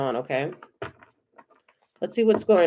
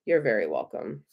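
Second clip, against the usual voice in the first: soft low repeat; 9 semitones lower, 6 dB quieter than usual. Real, not acted. Tarot reader repeats her thanks to a viewer, low and soft. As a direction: drop lower and softer on the repeat.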